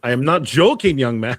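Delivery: loud, high-pitched reprimand; stern scolding. Acted. Innkeeper holding a young man by the ear, loud scolding.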